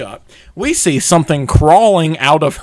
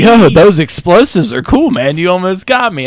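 loud, higher mock stoner; 4 semitones higher, 7 dB louder than usual. Demon brother admiring explosions, loud lightly voiced stoner. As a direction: call it out like an impressed stoner. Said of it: "He was like some stoner".

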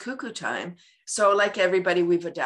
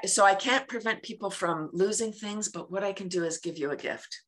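normal talking voice

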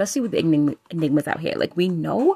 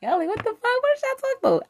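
much higher, squeaky stunned friend; mock disbelief; faster than usual. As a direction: act like your friends can't believe it. Voicing friends' shocked reaction.

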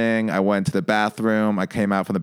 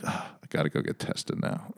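voiceless, much softer grumble; mock whispered grumble; muttered aside; 5 semitones lower, 11 dB quieter than usual. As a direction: whisper it to yourself in disgust. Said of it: "I'm like".

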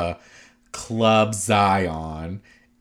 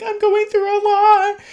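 much higher, louder whiny imitation; mock whine; 8 dB louder than usual. Podcaster mimics castmate pleading she's suffering, mock high whine. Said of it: "Jen's just like".

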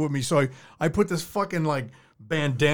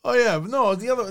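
ordinary delivery

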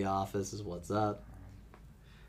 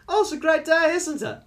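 high, lilting mock-Irish small talk; loud start in sing-song Irish, easing off; cheerful Irish accent; 7 dB louder than usual. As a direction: chirp it like a friendly Irish local. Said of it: "It's the dead opposite in Ireland".

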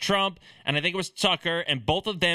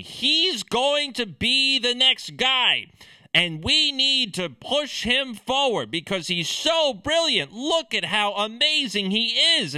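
higher-pitched, hammed-up hype; mock gushing; 5 semitones higher than usual. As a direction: imitate a fawning fan, overselling it. Mimics everyone gushing over the candidate.

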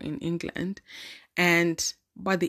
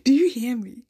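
loud, high, triumphant; 5 semitones higher, 6 dB louder than usual. Real, not acted. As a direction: shout it out proudly. Boasting about her score.

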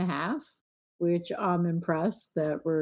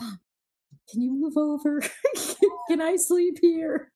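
put-on sleepy kid asking; 7 semitones higher than usual. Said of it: "He's like".

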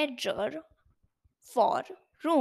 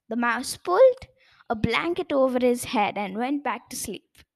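plain reading voice

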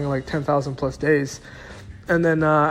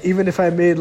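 ordinary delivery

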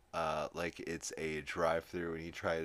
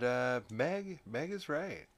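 normal talking voice

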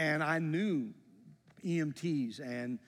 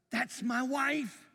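shocked realization; 8 semitones higher, much slower than usual. Retold or imitated. Recognising wife at the wreck.

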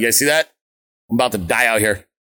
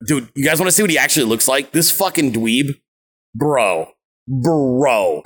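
ordinary talk, nothing marked

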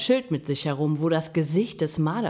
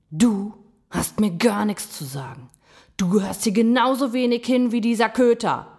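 raised, angry voice building louder; angry rebuff; 4 semitones higher, 5 dB louder than usual. A narrator's reading. Gram turns on the raccoon, angry and louder. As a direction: snap back, getting louder.

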